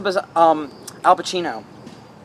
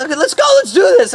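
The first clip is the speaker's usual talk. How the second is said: eager challenge; 12 semitones higher, 6 dB louder, faster than usual. Retold or imitated. Quoting opponent eager to fight.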